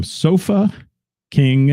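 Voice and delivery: SOFA, pause, then KING, each set apart; deliberately slow. Shown as a demonstration. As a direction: say Sofa and King slowly as two separate words. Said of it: "I have to say that slow".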